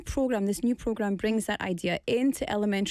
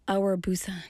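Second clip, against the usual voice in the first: whisper; 3 semitones lower than usual. Acted. Slogan said in hush.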